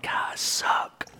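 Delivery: whispered, slow, under his breath; self-pitying whisper. Retold or imitated. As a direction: whisper it like a private confession to God. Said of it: "and then I'm like".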